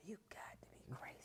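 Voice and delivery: hushed, voiceless aside; whispered disbelief. Retold or imitated. Player whispering about her coach to herself. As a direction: breathe it under your breath like a secret.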